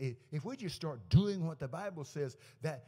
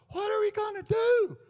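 very high, loud mock panic; panicked pastor; 10 dB louder than usual. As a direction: cry out in exaggerated alarm. Acting out the shocked pastor.

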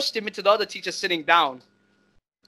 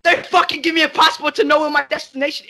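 heated rant; 8 semitones higher, 5 dB louder than usual. Real, not acted. Heated rant about school.

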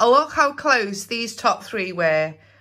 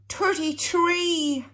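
surprised gasp; 7 semitones higher, slower than usual. Real, not acted. Gasp at a favourite number.

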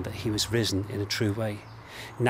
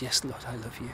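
quiet sincere reply; 7 dB quieter than usual. Acted. Quiet personal reply to Jesus.

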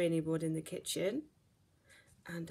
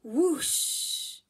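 loud, high whooshing blast-off; rocket whoosh; 5 semitones higher, 8 dB louder than usual. A narrator's reading. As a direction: belt out the rocket noise.

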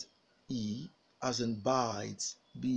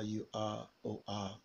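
normal talking voice

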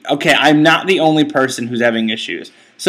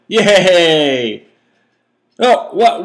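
ordinary talk

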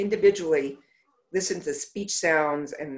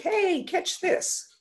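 high, slow playful call; 14 semitones higher, much slower than usual. Retold or imitated. Therapist playing pretend with a young patient, playful high call. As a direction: lob it to her like a game. Pretend swim game with child.

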